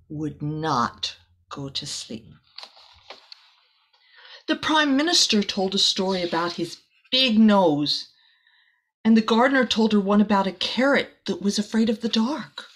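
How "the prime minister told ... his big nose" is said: ordinary delivery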